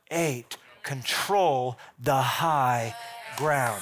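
drawn out, slow and deliberate; slow emphatic. Acted. Preacher drilling the point home, slow and deliberate. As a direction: lay each word down slowly.

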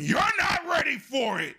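high, loud doom proclamation; loud warning. Real, not acted. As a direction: proclaim it like a prophet.

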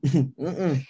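usual speaking voice

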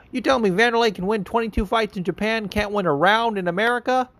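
high, put-on Chael voice, mocking disbelief; imitating Chael. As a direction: ham up Chael's incredulous trash talk.

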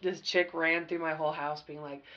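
ordinary delivery

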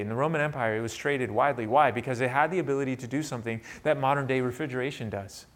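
ordinary delivery

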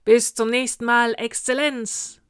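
booming salute in unison style; thundering reply. A narrator's reading. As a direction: thunder it back like troops on parade. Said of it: "donnerte das Heer".

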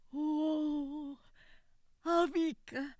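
put-on high, frail old woman; a trembling old mother welcoming her son; elderly mother, tender and frail; old mother's voice. A narrator's reading. Old mother greets returning son.

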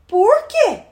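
put-on high-pitched childish question; pestering little girl. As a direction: needle big sister like a nagging kid. Little girl pesters older sister.

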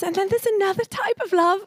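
lightly hammed-up fumbling young man; imitating Ben. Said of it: "And then he goes".

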